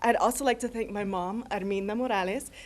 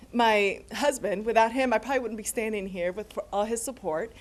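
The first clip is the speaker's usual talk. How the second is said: ordinary talk, nothing marked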